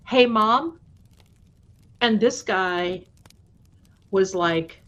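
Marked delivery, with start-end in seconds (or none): 0.1-0.8 lightly voiced mimic of a son calling; imitating son's voice. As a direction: call out to mom like a son. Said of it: "in my son's voice from downstairs say".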